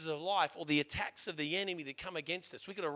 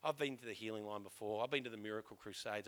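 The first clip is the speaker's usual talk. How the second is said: resigned mumble; 6 semitones lower, 9 dB quieter than usual. Retold or imitated.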